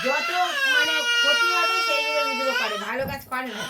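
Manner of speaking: loud tearful outburst, high and strained; crying complaint. Real, not acted. Complaining through tears about the boy who breaks things. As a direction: sob it out while protesting.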